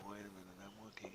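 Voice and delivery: hushed whisper while reading chat. Real, not acted. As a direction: whisper it under your breath. Checking chat mid-conversation.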